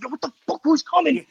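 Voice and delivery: high-pitched alarmed questioning, retold; startled. Hears knocking at the door at home, startled. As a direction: replay being caught off guard, voice shooting up.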